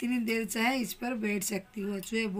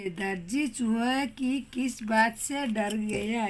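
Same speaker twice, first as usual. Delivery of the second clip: धीमा शिक्षक प्रश्न; 4 dB louder, much slower than usual. Shown as a demonstration. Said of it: "teacher asks class slowly".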